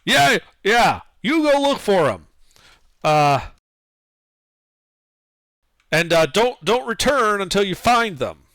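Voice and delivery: loud, high dwarf guard; gruff guard shout. Acted. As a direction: yell orders at the lackey. Guard answers loudly.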